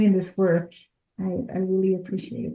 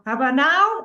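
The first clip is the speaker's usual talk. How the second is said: insistent louder nudge; 7 semitones higher, 4 dB louder than usual. Retold or imitated. Universe pushing, final time.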